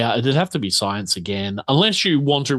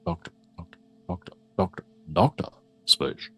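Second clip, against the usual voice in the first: low, soft late-night radio murmur; radio ASMR voice; 7 semitones lower, 5 dB quieter than usual. Shown as a demonstration. Game master warming up a voice for Spoosh's letter, radio ASMR voice. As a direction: drop deep and quiet, testing the words like a mic check. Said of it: "radio and A S M R voice here".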